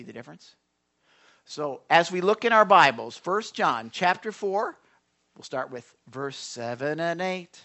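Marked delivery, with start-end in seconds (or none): none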